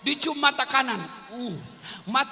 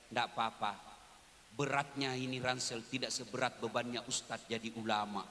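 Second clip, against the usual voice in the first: soft, tender, much lower and quieter; a loving wife answering her husband, softly; devoted wife's reply; 12 dB quieter than usual. Retold or imitated.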